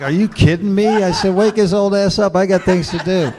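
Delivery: high, loud mock outrage with a laugh; hammed-up indignation at the secretary; indignant retold outburst.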